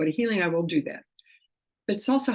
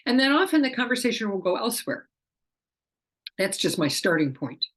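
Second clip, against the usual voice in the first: ordinary talk, nothing marked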